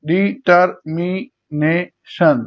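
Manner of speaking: Determination very slowly in five beats; di, tar, mi, ne, shon separated in determination; syllable by syllable. Shown as a demonstration. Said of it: "পাঁচবার উচ্চারণ হচ্ছে".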